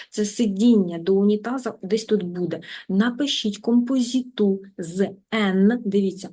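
usual speaking voice

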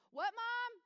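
high-pitched mock kid holler; yelling son. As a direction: imitate a child calling to mom. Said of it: "You don't have to yell".

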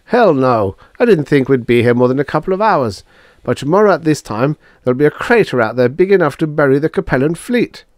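high, loud, fast alarm; agitated. Acted. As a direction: rush it out in panic. Drummond alarmed explanation.

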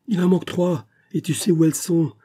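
furious retort. A narrator's reading. Father raging at son who denies the theft. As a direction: throw the lie back at him.

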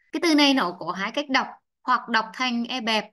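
ordinary talk

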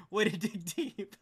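laughing through the words, slowly. Real, not acted. Streamer laughing at a big chat bet, slow laughing praise. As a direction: chuckle it out, amused.

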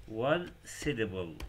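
slowly, syllable by syllable; slow demo. Shown as a demonstration. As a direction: draw out one syllable for the class. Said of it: "إذا نقول هذه الكلمة هي". (نطق بطيء)

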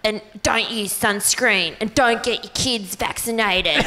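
put-on Pete Evans accent. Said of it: "Also, also sunscreen".

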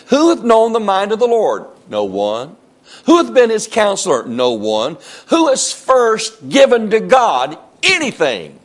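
high, loud, ringing rhetorical questions; full-force preaching. Real, not acted. Preacher challenging the congregation with scripture, full-force preaching.

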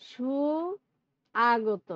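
সুআগত drawn out slowly as সু-আগত; wrong split pronunciation. Shown as a demonstration. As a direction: say সুআগত very slowly, each part apart. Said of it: "আমরা কিন্তু সুআগত বলি না". (সুআগত ধীরে ভেঙে উচ্চারণ)

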